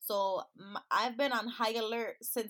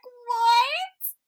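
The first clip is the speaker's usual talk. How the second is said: shocked; 13 semitones higher, 13 dB louder than usual. Retold or imitated. Shocked at brother's reply.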